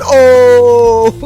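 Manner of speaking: long, high, loud "oh"; drawn-out mock crowd holler; imitated hype "oh". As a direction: imitate a hype man reacting to a burn. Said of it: "I can see you going".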